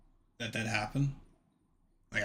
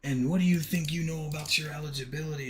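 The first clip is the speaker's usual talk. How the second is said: a hint of an irate coach cussing him out; mock angry rant, pitched higher; imitating the coach boiling over; 5 semitones higher than usual. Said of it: "he starts cussing".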